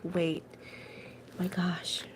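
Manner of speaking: quiet, whispered "my gosh" of alarm; retold startled whisper; hushed shock. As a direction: drop to a near whisper in mock panic. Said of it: "'cause I was like".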